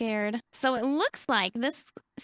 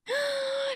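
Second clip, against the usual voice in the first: high gasp; 14 semitones higher than usual. Real, not acted. After announcing vampire.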